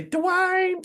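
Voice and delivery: very high, drawn-out Southern-belle whine; Whitley. Acted. Host showing class how she talked, high-pitched imitation. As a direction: imitate her with a high, stretched squeal. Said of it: "Can you do an imitation of Whitley?".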